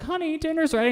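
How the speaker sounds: high, sweet housewife call; high wife voice. Acted. Wife calling her husband to dinner, sweet and high.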